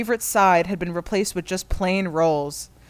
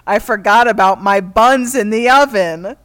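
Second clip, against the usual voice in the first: loud punchline; 9 dB louder than usual. Acted. Reading a punchline loudly.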